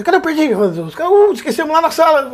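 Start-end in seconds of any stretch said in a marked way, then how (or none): 0.0-0.6 mock call. Retold someone asking aloud. 1.1-2.3 mock sheepish excuse. Retold staff excuse.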